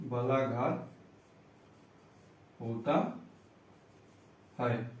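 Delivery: slow teacher giving out a rule piece by piece; dictation. Shown as a demonstration. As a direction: dictate with long pauses between words.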